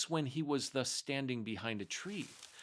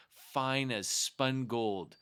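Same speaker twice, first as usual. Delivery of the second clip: ordinary delivery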